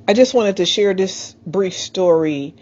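normal talking voice

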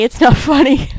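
nervous laugh. Acted. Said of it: "It's a nervous laugh".

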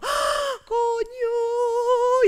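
chillido de sobresalto imitado, mucho más agudo; grito agudo de susto imitado. Retold or imitated. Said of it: "es como". (chillido agudo de susto fingido)

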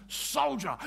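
high barked call of Soldier; sergeant's bark. Retold or imitated. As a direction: bark it like a sergeant on the parade ground. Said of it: "He says".

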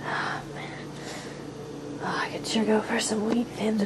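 hushed, breathy craving for a snack; whisper. Acted. Alone in the dark kitchen, whispering a craving. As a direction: whisper it so nobody wakes. Sneaking a late-night snack.

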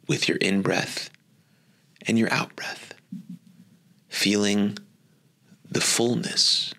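gently paced, unhurried phrases that follow the breath; guided meditation. Acted. Teacher guiding listeners into attention on their breath, guided meditation.

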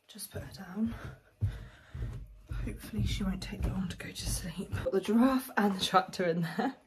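hushed real whisper. Real, not acted. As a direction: whisper so she stays asleep. Child just put to sleep.

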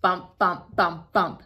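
bouncy bump bump sound effect. Acted. Reading to kids, sounding out the sleeping bags bumping. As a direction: bounce each bump like a falling bag.

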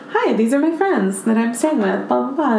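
Marilyn's natural voice. Retold or imitated. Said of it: "her talking in her regular voice".

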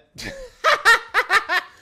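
very high loud mocking cackle. Real, not acted. Streamer mocking angry chat, loud high cackle.

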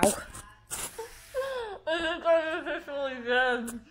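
talking through a mouthful; words around a bite of carrot; mouth full. Real, not acted. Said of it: "Now she has a mouthful of carrots".

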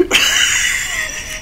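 a squealing burst of laughter; high laugh. Real, not acted. Laughing at the roar.

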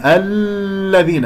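cling to the L in الذين: "alllladhina"; wrong held lam. Shown as a demonstration. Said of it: "لما أقول لا تمسك". («الذين» بإطالة زمن اللام خطأً)

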